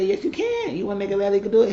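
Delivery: high-pitched mock encouragement; high urging voice. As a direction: coax him up high, imitating. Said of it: "He said".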